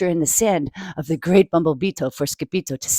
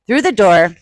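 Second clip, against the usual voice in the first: plain reading voice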